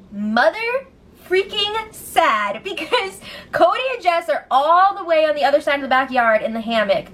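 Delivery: ordinary delivery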